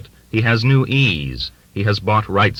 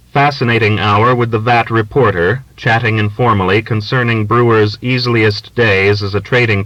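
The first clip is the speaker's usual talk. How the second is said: brisk, louder newspaper read; reading out the typeset story, a touch louder; news copy read; 2 semitones higher, 4 dB louder than usual. A narrator's reading. Narrator reading the printed newspaper story in a brisk, louder tone.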